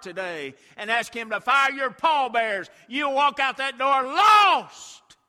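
high, loud, rhythmic revival preaching; fiery preaching. Real, not acted. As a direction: drive it home at full force. Preaching at full force.

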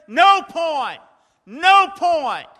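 high, loud repeated call; referee yelling. Retold or imitated. Referee waving off the basket, loud yell. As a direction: wave it off like a referee. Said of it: "the referee's yelling".

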